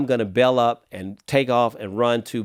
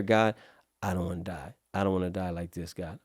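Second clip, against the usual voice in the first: quiet plea; 5 semitones lower, 9 dB quieter than usual. Retold or imitated. Pleading with God in memory.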